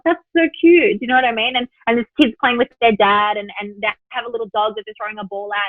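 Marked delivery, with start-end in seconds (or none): 0.1-1.0 put-on gushing over cute kid. Sees kid playing, gushing.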